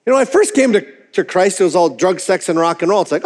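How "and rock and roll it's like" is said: normal talking voice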